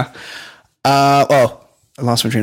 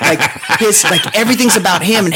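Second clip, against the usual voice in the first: ordinary talk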